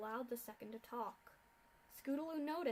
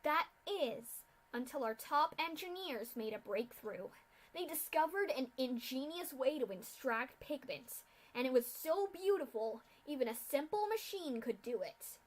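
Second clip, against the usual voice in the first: loud villain lecture; 5 dB louder than usual. Acted. Masked official continues lecture.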